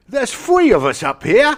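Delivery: high, loud put-on Cockney; bad Cockney accent. Host joking about a bad accent, in hammed Cockney. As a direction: lay on a terrible Cockney.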